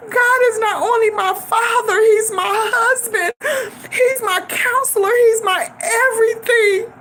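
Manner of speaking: real crying, pitched high; tearful. Real, not acted. Daughter talking of God as her everything, crying. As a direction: testify through tears, voice lifted high. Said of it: "always have the ability to make me cry".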